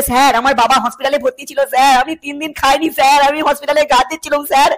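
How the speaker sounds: a student faking tears to get his cheat sheet back; mock tearful pleading. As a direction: fake sob story, pleading harder each line. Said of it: "emotional blackmail করবে".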